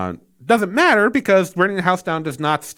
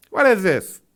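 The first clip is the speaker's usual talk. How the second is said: louder, higher, slowed exasperation; exasperated; 8 semitones higher, 3 dB louder, slower than usual. Real, not acted. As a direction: demand an answer from the screen. Fed up with the tonal shift.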